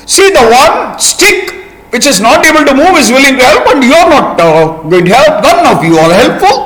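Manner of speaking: high, reproachful little ant; scolding ant. Acted. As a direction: scold them all from a small high voice. Angry ant scolds everyone.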